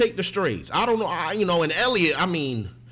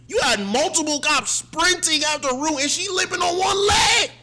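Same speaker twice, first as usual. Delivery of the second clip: high, loud disbelieving yell; outraged shout; 11 semitones higher, 4 dB louder than usual. Real, not acted. Outraged at the cops.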